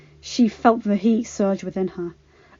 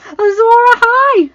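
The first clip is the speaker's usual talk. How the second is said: much higher, louder scream; 11 dB louder than usual. Acted. Arya, proud of her brother, screaming. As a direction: scream it with pride. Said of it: "screamed Arya".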